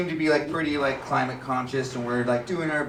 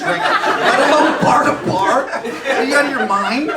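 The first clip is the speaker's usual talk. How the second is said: higher, louder, laughing incredulity; incredulous outburst; 8 semitones higher, 6 dB louder than usual. Real, not acted. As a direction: scoff in high disbelief at the idea. Incredulous rant, big pitch jump.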